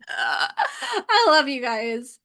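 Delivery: high, drawn-out laughing voice. Real, not acted. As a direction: laugh through the words, loud and fond. Laughing at the chat's joke.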